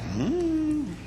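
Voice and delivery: high skeptical hum; a doubtful high hmm. Retold or imitated. Daughters doubting his report.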